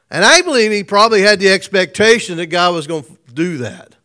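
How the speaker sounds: ordinary talk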